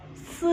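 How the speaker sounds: "S" as a hiss with tongue behind teeth; dental S. Shown as a demonstration. Teacher giving the S example, dental. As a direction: hiss "S" into a short buzzed vowel. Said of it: "lidahnya di belakang gigi".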